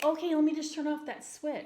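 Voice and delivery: sarcastic. Retold or imitated. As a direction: fire back with mock compliance. Sarcastic reply to being told calm.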